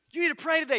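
a high, loud mock warning; mock urgent thought. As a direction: parody an alarm going off in your head.

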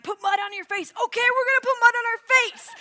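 put-on girls gamely agreeing, high and loud; chirpy all-in yes from eager campers; high, loud mock enthusiasm. Girls agreeing to camp tasks.

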